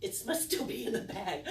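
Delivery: usual speaking voice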